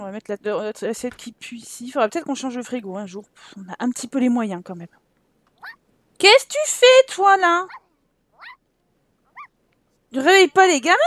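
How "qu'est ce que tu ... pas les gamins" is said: high, loud exasperated scolding. Acted. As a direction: tell the sim off. Scolding a misbehaving sim. (gronder, aigu et fort)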